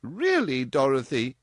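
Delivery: a touch of shocked surprise; mildly shocked. A narrator's reading. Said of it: "with widening eyes".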